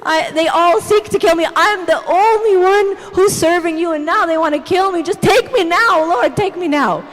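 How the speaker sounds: hammed-up desperate plea, high and loud; loud, melodramatic mock despair; over-the-top drama. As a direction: wail it to heaven like a drama queen. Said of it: "And the drama".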